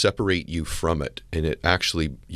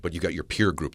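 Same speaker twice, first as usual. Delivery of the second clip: ordinary delivery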